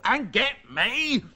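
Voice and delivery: slow, high, drawn-out order; petulant, building insistence; angry demand. A narrator's reading. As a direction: stretch out the demand imperiously. Angry son demands bananas.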